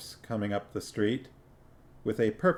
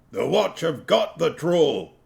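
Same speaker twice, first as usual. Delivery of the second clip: higher, louder dwarf accusing; a louder, higher dwarf voice arguing; 6 semitones higher, 5 dB louder than usual. A narrator's reading. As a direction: insist like an angry dwarf. Dwarf insists.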